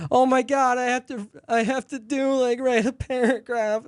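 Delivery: high-pitched mock complaint; exaggerated high kid whine; whining fourth grader.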